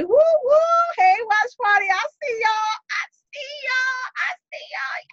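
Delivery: high, whooping call to the crowd; excited shout-out. Real, not acted. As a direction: holler hello to your watch party. Calling out to the watch party.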